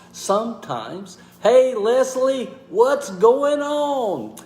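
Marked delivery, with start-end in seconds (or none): 1.4-4.4 real bright, stretched-out shout-out; excited greeting. Real, not acted. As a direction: call out a big hello to a viewer. Calling a greeting to a viewer.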